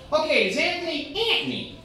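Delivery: put-on high Queens accent; mock Queens mom on the phone; Queens lady. Lady from Queens on the phone, Queens accent. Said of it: "this lady from Queens".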